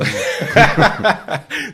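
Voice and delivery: a high, loud burst of laughter; real burst of laughter. Real, not acted. Cracking up at the ch'ti joke about the speaker's accent, real laughter.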